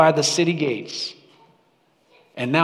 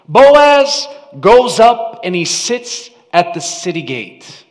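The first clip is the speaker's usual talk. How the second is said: normal talking voice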